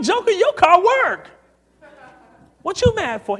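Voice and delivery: high, loud comic protest; mock-shout back at a grumpy boss; acted incredulous retort.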